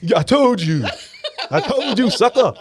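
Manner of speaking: high, laughing mock taunt; gloating stepdad. Stepdad taunting the crying mother, hammed-up laughing gloat. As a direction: rub it in with a cackle. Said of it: "Still not reading the room".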